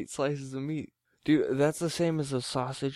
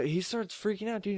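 ordinary delivery

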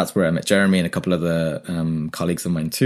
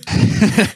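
high burst of laughter; 11 semitones higher, 5 dB louder than usual. Real, not acted. Laugh at being asked.

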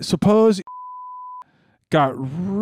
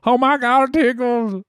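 being tickled; 8 semitones higher than usual. Acted. Voicing the tickled woman on video.

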